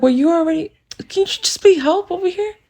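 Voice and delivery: high-pitched plea for help; acted-out urgent request; urgent appeal. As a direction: call on them, exasperated, to pitch in.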